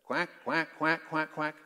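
short, repeated duck quacks; human-voiced duck call. Shown as a demonstration. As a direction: quack like a duck in a quick string. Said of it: "what do ducks say?".

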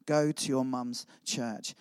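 slow, low, gentle repeated prompting. Retold or imitated. The same voice calling the young man, gently. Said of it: "I heard it again".

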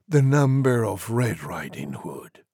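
plain reading voice

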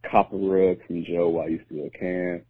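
ordinary talk

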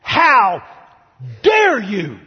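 loud, indignant rebuke with a wagging finger; scolding. Pretend. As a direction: scold him sharply, full of outrage. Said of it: "shake his finger, and say".

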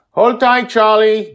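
put-on a father yelling to the boy holding Joy. A narrator's reading. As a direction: shout across the battle. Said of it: "Justin yells at him".

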